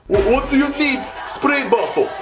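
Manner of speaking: mock Bulgarian accent, shouted high. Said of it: "so that explains the accent".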